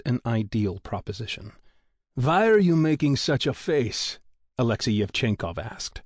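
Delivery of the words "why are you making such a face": put-on a lightly Russian-accented engineer asking, slowed; a hint of Russian in a curious question; the Russian engineer teases his colleague, lightly accented. A narrator's reading. Russian engineer voiced in story.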